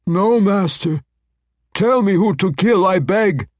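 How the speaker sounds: high, loud demon begging; alarmed pleading. A narrator's reading. Demon begs the master threatening to banish her, alarmed and high. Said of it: "her face a mask of alarm".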